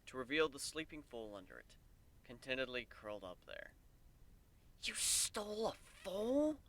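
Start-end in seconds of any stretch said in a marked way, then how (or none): 4.8-6.6 higher, slow, aghast; stunned disbelief. A narrator's reading. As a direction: Warren aghast at the mare.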